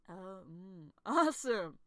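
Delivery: ordinary talk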